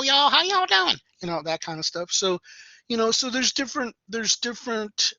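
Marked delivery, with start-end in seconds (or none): 0.0-1.0 high, loud twangy East Texas "y'all"; East Texas twang. Acted. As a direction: imitate a twangy East Texan greeting, pitched way up. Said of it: "a little twangy out there".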